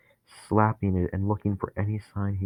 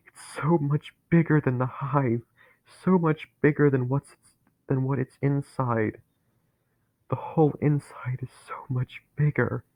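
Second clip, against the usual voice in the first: terrified awe; 6 semitones higher than usual. Acted. Dennis describing the vast center, awed and afraid. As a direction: gasp at its size.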